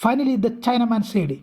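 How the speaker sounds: a careful read-aloud for students; slow story reading. A narrator's reading. Teacher reading the English text aloud to her class, slow and deliberate. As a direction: read each English phrase slowly and deliberately.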